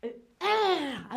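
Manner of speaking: drawn-out tennis grunt; tennis player's cry. Shown as a demonstration. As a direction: cry out on a two-handed shot. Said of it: "Autre cri aussi". (cri de frappe au tennis)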